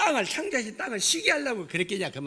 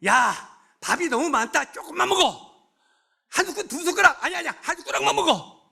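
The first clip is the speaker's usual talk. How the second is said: mock shouting; 6 semitones higher than usual. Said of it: "앞으로 계속 소리 지를게요".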